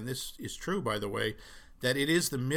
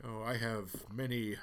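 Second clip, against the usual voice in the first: soft, low, drawn-out boast; slow down into a hushed, self-important murmur; Ron Burgundy; 3 semitones lower, 7 dB quieter, slower than usual. Retold or imitated. Ron trying to impress Veronica, mock suave. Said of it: "weird way Ron tries to impress Veronica".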